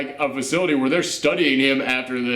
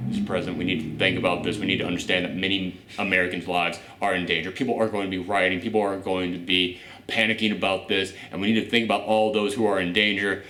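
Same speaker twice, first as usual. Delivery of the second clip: imitating the advisor; 10 semitones lower, 4 dB quieter, faster than usual. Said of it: "and the woman is like".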